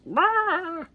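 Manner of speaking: shrill imitated spider cry; high mock screech. The spider dying, a high mock screech.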